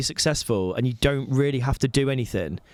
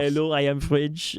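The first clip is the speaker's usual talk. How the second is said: mock French accent; 2 semitones higher than usual. Pitching French as sexiest to co-host, hammed-up French accent. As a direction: play at being French. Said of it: "it's a very nice accent".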